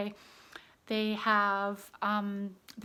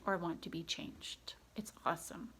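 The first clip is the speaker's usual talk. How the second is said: whisper; 5 semitones lower, 11 dB quieter than usual. Real, not acted.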